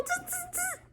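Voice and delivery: high, soft buzzing chatter; imitated monkey talk. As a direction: buzz like a storybook monkey. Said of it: "That's how they were talking".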